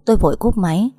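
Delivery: ordinary delivery, nothing marked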